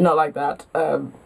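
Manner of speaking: usual speaking voice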